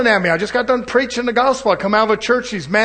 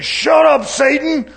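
higher, louder stern rebuke; preacher rebuking the devil, higher and louder; 8 semitones higher, 4 dB louder, much slower than usual. Retold or imitated. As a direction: snap back at the tempter. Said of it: "And I'm saying".

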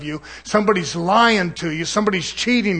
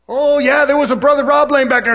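mock taunt; 7 semitones higher, 4 dB louder than usual. Angry man mocking the preacher.

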